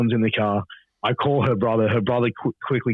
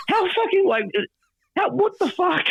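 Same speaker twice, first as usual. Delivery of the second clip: disbelieving wife. Retold or imitated. Wife stunned that her husband is famous, high outraged disbelief. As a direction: voice her shock at fame going high.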